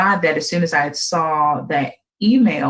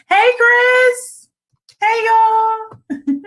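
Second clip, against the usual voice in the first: much higher, louder hello; excited greeting; 4 dB louder, much slower than usual. Real, not acted. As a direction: welcome viewers joining live. Greeting live viewers.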